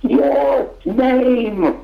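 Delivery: high, drawn-out imitated call; mock helpful translation, pitched high; accompanist prompting. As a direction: call it up slowly from the orchestra pit. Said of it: "the accompanist from the pit says".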